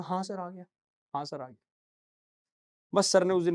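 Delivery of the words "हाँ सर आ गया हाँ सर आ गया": mumbled yes sir. Retold or imitated. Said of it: "हमेशा यही कहता था".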